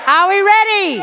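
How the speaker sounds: rallying shout. Real, not acted. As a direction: fire up the crowd to answer back. Rousing the crowd for a louder answer.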